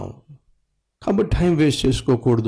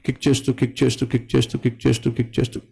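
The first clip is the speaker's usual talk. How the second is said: repetitive kick-start rhythm. Retold or imitated. Mimics repeatedly kick-starting an old bike.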